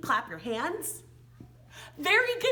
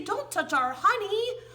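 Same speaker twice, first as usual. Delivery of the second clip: a touch higher, mock bossy bees; lightly voiced bees; 7 semitones higher than usual. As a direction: play the cross little bees lightly. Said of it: "They say".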